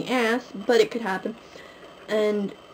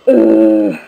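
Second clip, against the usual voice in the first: groan; 10 semitones lower, 13 dB louder than usual. Real, not acted. Stressed about late present.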